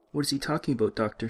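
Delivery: put-on a French-accented nurse asking, puzzled.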